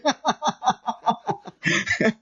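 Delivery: long amused laughter; rolling chuckles; real laugh. Real, not acted. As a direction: crack up at your own comeback. Laughs at own reply.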